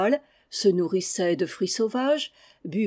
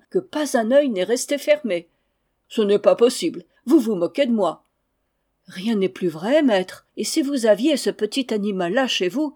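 eager servants and master; 2 semitones higher, 4 dB louder than usual. Acted. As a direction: rush to tell the master the marvel. Valets racontent au maître. (les valets racontent au maître, voix excitée et forte)

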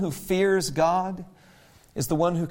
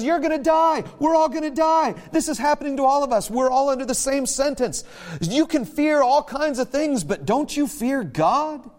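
urgent rebuke; 7 semitones higher than usual. Acted. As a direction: drive the warning home with force. Criminal rebukes the other criminal.

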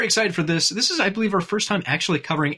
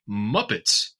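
ordinary delivery

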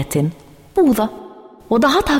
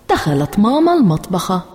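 ordinary delivery, nothing marked

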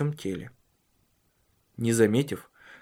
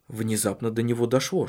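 ordinary talk

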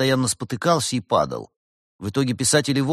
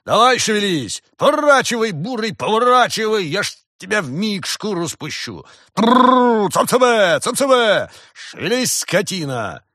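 high, full-force angry drover's holler; yelling; 12 semitones higher, 8 dB louder than usual. A narrator's reading. Farmer threatening his oxen, furious yelling. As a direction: roar orders and rolled trills at the beasts. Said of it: "заорал голос".

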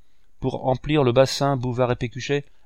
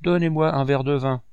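a hint of a rough vagabond's demand; hoarse voice; 4 semitones higher than usual. Ragged vagabond demanding wine from the guests, mild hoarse growl. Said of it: "d'une voix rauque".

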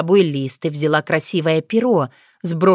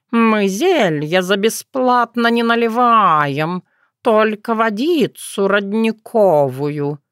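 slow, lilting cat; talking cat; 3 semitones higher, slower than usual. Acted. Cat telling a visitor about the drinks, slow and drawn-out. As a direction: draw it out like a self-important cat.

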